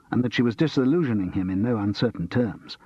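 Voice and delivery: ordinary delivery, nothing marked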